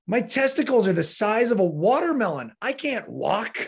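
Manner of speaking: high-pitched, incredulous complaint; exasperated protest. Retold or imitated. Patient telling nurse why walking is impossible, exasperated mock protest. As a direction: imitate an indignant patient pleading his case.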